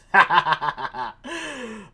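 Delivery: long rolling chuckle; laughing. Real, not acted. Host after the penetration device joke, long chuckle. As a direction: laugh at your own punchline.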